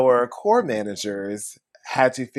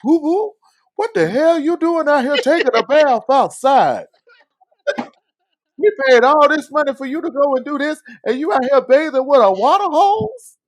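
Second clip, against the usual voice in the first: put-on high, loud old grandpa voice; grandpa impression; 7 dB louder than usual. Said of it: "That's my grandpa impression".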